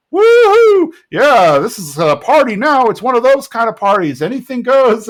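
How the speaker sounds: loud high party whoop and cheer; hammed-up party excitement; whooping Hank. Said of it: "and he's like".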